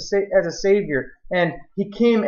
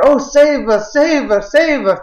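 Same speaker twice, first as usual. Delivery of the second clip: loud, high desperate cry, building; crowd crying out; 8 semitones higher, 6 dB louder, slower than usual. Acted. Preacher acting the crowd begging the coming king, a loud high cry. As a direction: cry out to be saved. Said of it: "the people are crying out".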